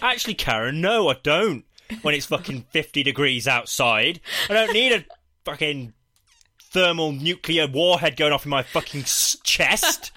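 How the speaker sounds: hammed-up indignant outburst; exasperated rant. Acted angry retort to coworker.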